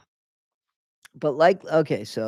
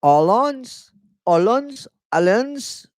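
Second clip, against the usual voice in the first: mock Cajun French, higher; 7 semitones higher, 4 dB louder, slower than usual. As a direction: say allons with a Cajun French lilt. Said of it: "Let's say it in, uh, French Cajun".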